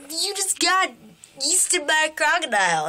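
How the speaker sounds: high-pitched incredulous reaction; amazed exclamation. Retold or imitated. Friends to man who escaped a crocodile, amazed. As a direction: call it out high, in retold disbelief.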